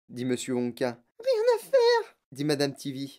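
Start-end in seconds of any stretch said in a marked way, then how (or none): 1.2-2.1 very high falsetto wail; distressed shriek. Acted. Sick mother answers the inventor's plea, shrieking. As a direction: wail back helplessly.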